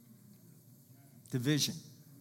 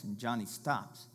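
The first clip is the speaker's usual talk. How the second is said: normal talking voice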